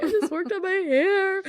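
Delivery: high, whiny complaint; upset whine. Retold or imitated. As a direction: wail it in a high pitch. Imagined upset over ruined hair.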